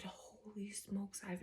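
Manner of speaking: hushed whisper of amazement; awed whisper. Retold or imitated. Vlogger recalling her amazement reading the book, whispered. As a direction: breathe it out in wonder.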